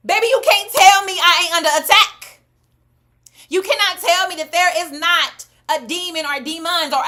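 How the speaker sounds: high, loud emphatic declaring; fired-up rant. Real, not acted. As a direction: proclaim it like you are under attack. Rants that she is under attack.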